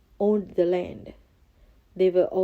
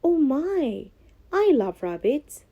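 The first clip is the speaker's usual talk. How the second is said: higher, delighted princess voice; 7 semitones higher, 4 dB louder than usual. Acted. Princess delighted by the rabbit gift, higher voice. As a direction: gush over the gift. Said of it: "said the princess".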